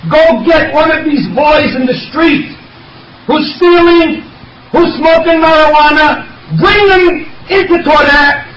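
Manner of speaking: high, impassioned preacher's call to action; rally the congregation, pleading high and strong; preaching at full force. Real, not acted. Eulogist urging mourners to honor the rabbi, fervent preaching.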